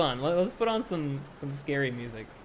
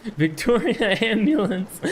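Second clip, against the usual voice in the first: giggling through the words, pitched up; laughing; 8 semitones higher, slower than usual. Real, not acted. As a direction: read it while cracking up. Laughing reading a funny chat message.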